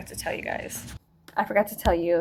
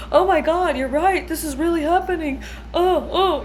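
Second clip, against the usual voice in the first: higher, louder mock panic; 8 semitones higher, 5 dB louder than usual. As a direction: ham up the sudden oh-my-God alarm. Workers realizing danger, panicking.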